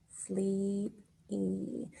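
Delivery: "sleepy" softly, as slee, pause, ee; split into syllables. Shown as a demonstration. Teacher shows pupils two syllables, split into syllables. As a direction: separate the final ee of "sleepy". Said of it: "So we have two syllables".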